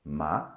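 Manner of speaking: "Ma" gliding upward like a question; second tone, rising. Shown as a demonstration. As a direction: overpronounce "Ma" with a rising pitch. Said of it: "your voice will go up a bit".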